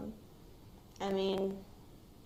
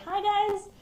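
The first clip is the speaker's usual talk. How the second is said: bright, much higher, louder greeting held over tears; forced cheerful hello; 13 semitones higher, 7 dB louder than usual. Retold or imitated. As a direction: sound cheerful so they won't worry. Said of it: "try not to cry and just, like, say".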